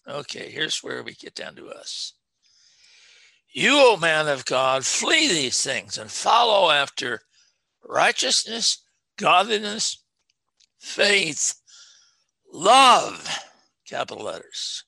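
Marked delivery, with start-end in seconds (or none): 3.5-13.3 loud, rising list of virtues, each word hit; fiery preaching. Acted. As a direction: preach it out to the congregation. Preaching scripture at full force.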